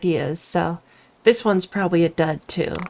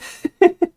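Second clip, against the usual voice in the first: short high cackle; a quick sly cackle; witch cackle; 11 semitones higher, 4 dB louder than usual. Acted. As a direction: witch amused at the trick. Witch laugh.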